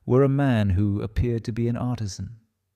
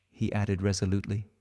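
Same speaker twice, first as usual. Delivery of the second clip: usual speaking voice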